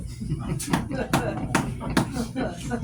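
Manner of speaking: breathy silent laugh at the punchline. Real, not acted. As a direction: laugh through it, barely voiced.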